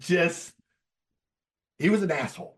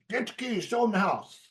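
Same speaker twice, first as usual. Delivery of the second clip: put-on high barked order from the old man; mock old-man command, pitched up; grandpa ordering; 9 semitones higher than usual. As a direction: imitate grumpy grandpa giving orders. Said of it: "looks at him and says".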